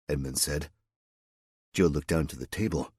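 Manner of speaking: ordinary delivery, nothing marked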